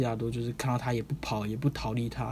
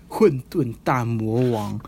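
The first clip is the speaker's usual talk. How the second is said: dramatic slow announcement; 6 dB louder, much slower than usual. Retold or imitated. Dramatic naming of demon king.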